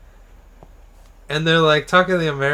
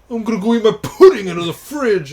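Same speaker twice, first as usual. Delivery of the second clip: loud mock boyfriend; 7 semitones higher, 7 dB louder than usual. Boyfriend announcing pudding plan.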